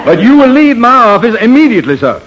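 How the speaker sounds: outraged Southern colonel ordering him out; indignant order. Acted. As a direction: throw him out of the office now. Colonel throws out a fake.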